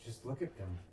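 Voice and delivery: quiet, understated quoted answer; softly acted retort; hushed reply. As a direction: say it low and soft. Acting the son's hushed reply.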